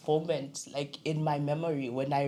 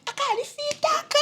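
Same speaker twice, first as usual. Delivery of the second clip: high-pitched mocking Zambian relative. Relatives teasing a dark child, high mocking squeal. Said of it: "they'll be like".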